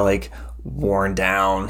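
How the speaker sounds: usual speaking voice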